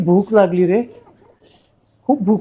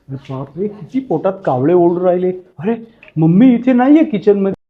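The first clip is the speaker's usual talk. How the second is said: ordinary talk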